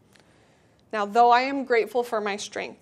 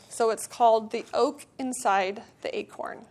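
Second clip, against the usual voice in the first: ordinary talk, nothing marked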